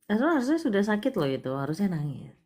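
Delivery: ordinary delivery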